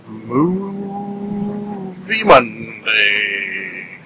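MOVIE MONDAY stretched very slowly, vowels held long; drawn out for emphasis. Shown as a demonstration. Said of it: "Draw it out like that a little bit to add emphasis".

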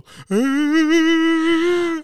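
long, very high fake wail; mock crying. Comic grieving over a movie death, mock crying. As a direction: bawl in hammed-up grief. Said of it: "crying like [name]".

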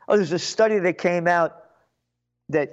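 ordinary talk

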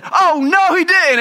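high, loud mock outrage; hammed-up indignant mother; outraged mama. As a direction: gasp it like an offended mom on the sideline. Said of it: "his mama said".

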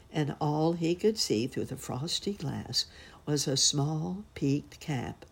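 plain reading voice

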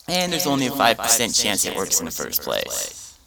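ordinary delivery

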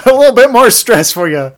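high, loud, mock-gleeful taunt; teasing tormentor. Game piling stress on the player, teasing. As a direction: needle the victim with loud glee.

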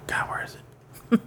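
unvoiced muttering while searching; whisper to self. Real, not acted. Looking up a release year, real whispered aside to himself. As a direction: whisper it under your breath while looking something up.

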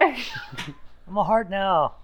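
high, very slow tiny voice; a small being drawing out its words; little voice. Acted. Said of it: "you hear a little voice coming from".

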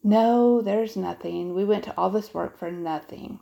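loud disappointed no, then grumbling; boy complaining to his sister below; complained. A narrator's reading. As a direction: sulk about the wasted work. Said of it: "Jasper complained".

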